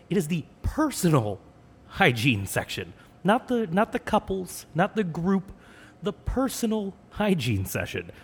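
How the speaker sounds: high-pitched comic indignation; mock exasperated lecture. Boyfriend lectures girlfriend about personal toothbrushes, mock exasperated. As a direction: lecture with exaggerated disbelief, hitting the key words.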